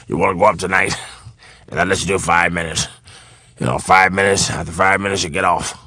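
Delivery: put-on low, gruff old-timer offer; acted low, offhand voice; imitating older comedian. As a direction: lay down terms in a low mumble. Acts Foxx's low offer.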